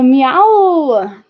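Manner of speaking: high, loud, swooping meow; loud cat meow. Pretend. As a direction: let out a big playful meow. Imitates a cat.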